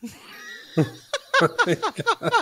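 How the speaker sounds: long high giggling laugh. Real, not acted. Host laughing at his own penis bit, high giggle. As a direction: burst into a high-pitched giggle.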